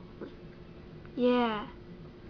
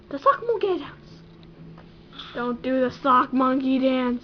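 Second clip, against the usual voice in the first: ordinary talk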